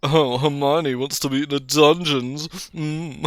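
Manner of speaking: high, loud, slow mock-knowing tease; suggestive teasing. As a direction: draw it out as playful innuendo. Teasing innuendo about Hermione's note.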